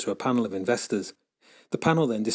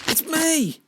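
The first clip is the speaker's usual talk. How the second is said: shouting. A narrator's reading. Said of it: "I shouted".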